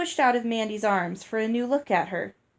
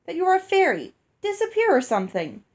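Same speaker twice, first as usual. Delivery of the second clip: eager demand; 9 semitones higher, 5 dB louder, faster than usual. Acted. Child insisting Mandy prove it.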